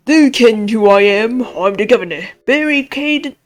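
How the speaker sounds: loud Scottish-style governor voice; indignant, booming character voice; blustering prison governor. Acted. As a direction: announce yourself loudly, full of self-importance. Voices gruff governor after voice test.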